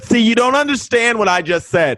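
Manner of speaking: high-pitched retort, reenacted; arguing back. Acted quarrel with the director.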